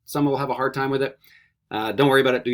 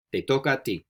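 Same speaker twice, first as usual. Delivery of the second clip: normal talking voice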